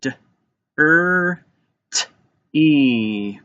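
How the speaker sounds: "D, er, t, e" as four separate sounds; sounded out. Shown as a demonstration. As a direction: tap out each sound: "D, er, t, e", pauses between. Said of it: "Let's tap it out".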